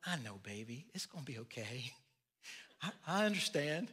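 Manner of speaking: soft, comforting mom voice; soothing mother. Retold or imitated. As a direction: hush a fussing toddler gently. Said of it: "Megan's over here going".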